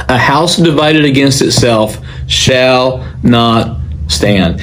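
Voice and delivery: slow proclamation, SHALL drawn out, pause before STAND; emphatic declaration. Retold or imitated. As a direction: declare it deliberately, "shall", "not" and "stand" set apart. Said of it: "stated in a very loud voice".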